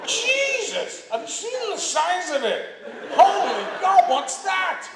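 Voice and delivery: squealing high with laughing shock; shocked gawking. Retold or imitated. Extras gawping at the Caligula set, high scandalised squeals. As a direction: gasp at each new sight, scandalised and thrilled.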